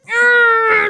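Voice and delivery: high strained cry; high effortful yell. Retold or imitated. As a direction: give every ounce. Maximum effort.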